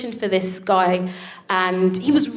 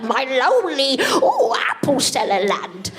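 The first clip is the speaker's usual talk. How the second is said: high, swooping fake Cockney; mock Cockney; 5 semitones higher, slower than usual. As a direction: caricature a Cockney street seller. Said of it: "a Cockney accent".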